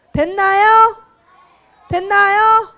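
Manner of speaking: loud, high, insistent question; rallying call. Real, not acted. Preacher asks children if they are ready, rallying call.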